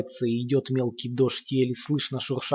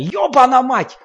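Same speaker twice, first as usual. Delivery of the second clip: much higher and louder, raging; frenzied roar; 11 semitones higher, 10 dB louder than usual. A narrator's reading. Lomov in a frenzy behind the door, raging roar. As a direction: rage out of control, stamping. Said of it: "ревёт он в неистовстве".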